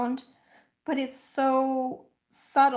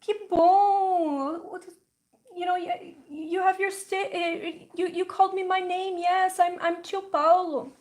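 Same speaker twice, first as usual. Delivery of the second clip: higher, Brazilian-tinged fond uncle voice; doting uncle; 6 semitones higher than usual. Retold or imitated. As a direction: coo at the toddler like a delighted uncle. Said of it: "And my uncle goes".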